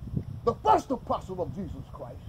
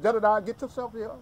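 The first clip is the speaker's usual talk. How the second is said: commanding mama; 5 semitones higher, 7 dB louder than usual. Retold or imitated. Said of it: "his mama done called on him, said".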